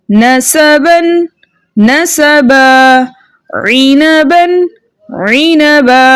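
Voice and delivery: slow level chant, one word at a time; tanween and pause drill. Shown as a demonstration. Teacher drills pupils on joined and stopped forms, slow chanted words. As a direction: chant each word, humming n then holding long a.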